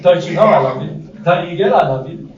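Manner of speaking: mock exasperated insistence on being loving; angry mother. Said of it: "which is often an angry feeling".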